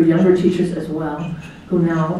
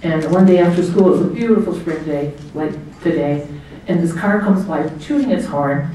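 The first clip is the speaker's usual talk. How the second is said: normal talking voice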